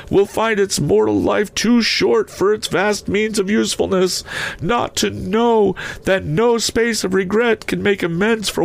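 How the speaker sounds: very high, anguished ghostly declamation; lamenting phantom. A narrator's reading. Phantom regretting its misused life, high lamenting voice. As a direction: pour out the regret in a high spectral wail.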